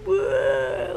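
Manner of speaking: mock whoa.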